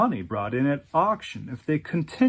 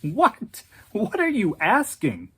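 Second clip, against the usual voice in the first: mock shriek; 7 semitones higher than usual. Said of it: "Patrick practically shrieked".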